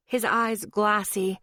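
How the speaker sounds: ordinary delivery, nothing marked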